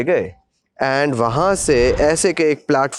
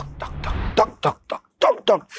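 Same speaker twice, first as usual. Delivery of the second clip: heartbeat sounds; 6 semitones higher, 4 dB quieter than usual. Retold or imitated. Heartbeat imitation.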